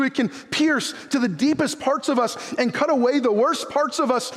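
high, driving preacher voice; preaching at full force. Acted. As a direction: preach hard to the congregation.

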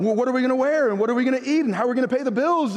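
quick, fussing mock worrier; fretting worrier. Pastor mimicking churchgoers fretting about food and bills, quick mock worry. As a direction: rattle off the worries in a rush.

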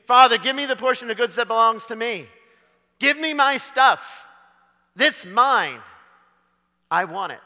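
the younger son demanding his inheritance from his father. Acted. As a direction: grab what he thinks is his. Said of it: "no longer demanding anything".

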